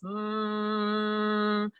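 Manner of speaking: soft steady hum; humming. Shown as a demonstration. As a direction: hum a long, even mm. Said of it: "Humming just sounds like this".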